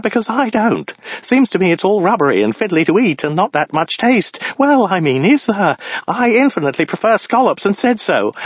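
high, frantic, aggrieved ranting; babbling madman. Acted. Madman complaining about the lobster trip, high frantic babble. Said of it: "he babbled".

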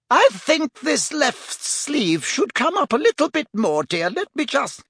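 high-pitched, fussy dressmaker; Madam Malkin. A narrator's reading. Shop owner fitting a sleeve, high and fussy. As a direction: dither brightly in a high lady's voice.

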